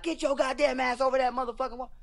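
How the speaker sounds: harsh shouted command at recruits, nothing polite; drill sergeant yell. Retold or imitated. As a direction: bellow it like a military drill sergeant. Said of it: "No, they be like".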